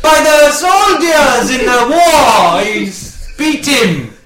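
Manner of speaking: loud, high, slow mock lament; Mister Chumbunga crying. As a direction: wail it out, easing off at the end. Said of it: "Do Mister Chumbunga crying".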